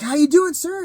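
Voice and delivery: high, loud hotel-worker hello; imitated cheery greeting. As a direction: chirp it like unsuspecting hotel staff. Hotel worker greets him, unaware.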